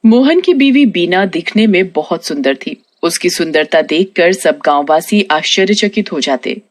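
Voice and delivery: ordinary delivery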